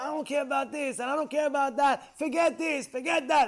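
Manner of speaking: high, mounting mock tirade; acted-out angry dismissal; imitated angry outburst. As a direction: throw it off like someone losing his temper. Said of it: "First he gets angry".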